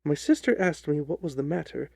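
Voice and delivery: ordinary delivery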